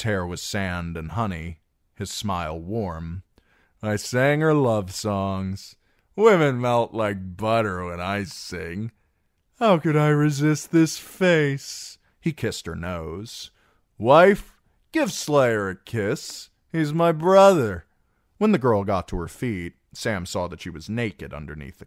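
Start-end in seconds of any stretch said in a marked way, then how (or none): 3.8-12.0 slow, swaggering tipsy brag; merry drunk bragging; boastful drunken singer. Acted. Plays Darion the drunken singer. 14.0-17.9 slow, swaggering tipsy brag; merry drunk bragging; boastful drunken singer. Acted. Plays Darion the drunken singer.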